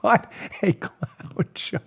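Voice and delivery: slow, near-whispered dismay; hushed disbelief. Real, not acted. Host reacts to Rosenstein's blunders, hushed disbelief. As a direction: let it out slowly under your breath. Disgusted comment after laugh.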